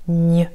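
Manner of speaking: "gne" said like ny in canyon; the gne sound. Shown as a demonstration. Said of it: "nous donnent le son gne".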